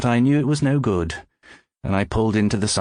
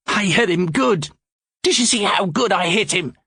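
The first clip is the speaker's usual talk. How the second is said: much higher, gleeful bragging; excited, out of breath; 11 semitones higher than usual. Acted. George boasts to his partner, breathless and gleeful. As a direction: crow about the punch. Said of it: "He was excited and out of breath".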